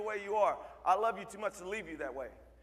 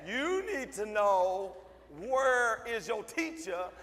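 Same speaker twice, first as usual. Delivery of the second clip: slow, deliberate mock echo; much slower than usual. Mocking kid's demand back at him.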